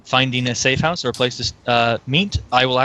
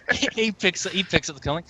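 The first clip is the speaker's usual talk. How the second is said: ordinary talk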